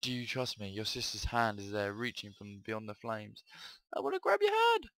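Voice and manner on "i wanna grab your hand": high, loud pleading call; high wailing plea; high desperate cry. Acted. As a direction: reach out desperately. Sister reaching from flames.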